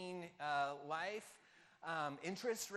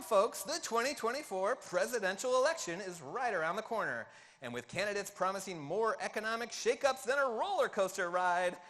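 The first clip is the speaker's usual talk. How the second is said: put-on Seinfeld accent; 2 semitones higher, 7 dB louder than usual. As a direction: sell it like an over-the-top stand-up bit. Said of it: "read this in a Seinfeld accent".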